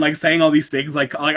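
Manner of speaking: ordinary delivery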